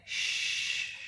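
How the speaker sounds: hushed, breathy shh; shushing whisper. Retold or imitated. Hushing a friend who says it's late, whispered shh. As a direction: shush them to keep the party going. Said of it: "And I said".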